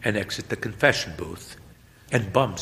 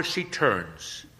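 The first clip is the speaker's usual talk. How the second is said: plain reading voice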